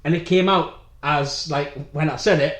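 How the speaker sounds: ordinary delivery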